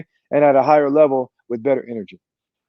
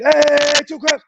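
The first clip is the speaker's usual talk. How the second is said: coach's clap call repeated; 11 semitones higher, much slower than usual. Shown as a demonstration. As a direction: demand the second try with more fire. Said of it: "Let's try it again".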